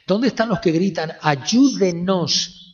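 urgent pulpit shout; crying out. Acted. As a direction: shout it to the back row. Preacher cries out for help.

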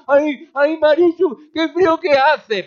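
high, loud whiny "ay, ay, Marichu"; shivering complainer. Retold or imitated. Pastor mimics a cold churchgoer calling to Marichu, high and whiny.

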